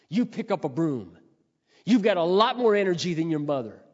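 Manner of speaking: heated preaching, rising in force; forceful preacher's scolding. Real, not acted. Scolding exhortation to young listeners.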